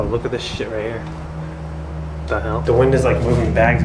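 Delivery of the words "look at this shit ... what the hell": hushed whisper; breathy whisper in the dark. Real, not acted. Sneaking into haunted hotel.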